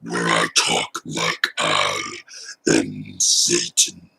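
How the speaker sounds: very low, slow reverse-air growl; demon voice. Shown as a demonstration. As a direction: growl it out like Satan. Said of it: "a weird reverse air thing".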